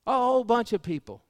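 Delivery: loud, high preacher's cry; booming pulpit delivery; full-force preaching. Acted. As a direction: preach it hard to the congregation.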